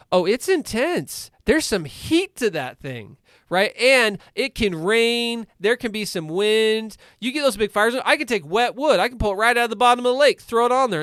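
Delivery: high and loud, building excitement; fired-up storytelling. Real, not acted. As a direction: paint the big bonfire with growing fire. Building up the bonfire image.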